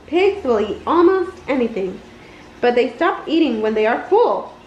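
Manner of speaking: plain reading voice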